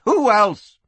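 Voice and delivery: high, loud exasperated retort; crying out. Acted. Madam answers while rushing downstairs, crying out. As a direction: toss it back loudly in Kitty's voice. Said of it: "Kitty cried, rushing for the stairs".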